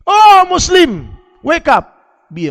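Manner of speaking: high, loud call to wake up; preacher calls out to the Muslims in English; rousing shout. Acted. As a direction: shout to rouse the faithful.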